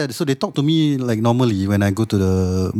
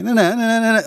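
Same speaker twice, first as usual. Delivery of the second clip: sing-song na-na, rising higher; scouse lilt; 10 semitones higher than usual. Shown as a demonstration. Showing friends the sing-song Scouse tune, higher. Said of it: "it's tune".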